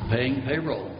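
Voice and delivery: ordinary talk, nothing marked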